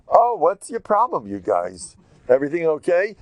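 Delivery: high, loud sarcastic jeer; mocking taunt. Eliyahu taunting the prophets of Baal, loud mockery. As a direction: needle them with fake concern.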